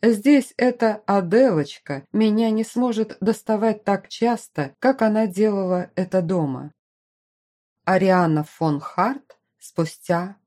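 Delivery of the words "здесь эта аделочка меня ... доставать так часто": ordinary delivery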